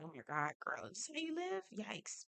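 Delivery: quiet gossipy murmur; hushed mock aside. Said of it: "I was like".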